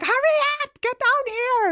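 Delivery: very high mock shriek; urgent, high-pitched imitated holler; retold panicked scream. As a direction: imitate a panicked daughter yelling for help. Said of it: "I can hear the panic in her".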